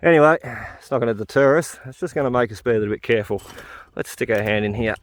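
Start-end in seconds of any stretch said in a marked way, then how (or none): none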